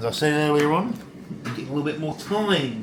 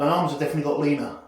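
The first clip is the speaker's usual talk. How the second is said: ordinary talk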